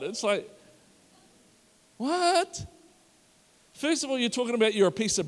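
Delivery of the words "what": high, stunned "what?"; baffled what. Retold or imitated. Crowd member hearing Jesus, high and baffled. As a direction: react like you can't believe it.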